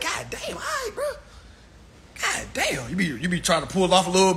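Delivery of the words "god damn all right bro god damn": high, half-laughing groan at the smell; disgusted laugh. Retold or imitated. Comic smells coworker's breath, high laughing disgust.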